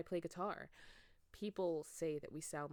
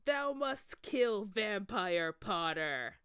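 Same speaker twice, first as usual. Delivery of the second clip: loud, slow booming order; loud, slow villain's demand; villain command; 7 dB louder, much slower than usual. A narrator's reading. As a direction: command her slowly and loudly. Voldemort commands her.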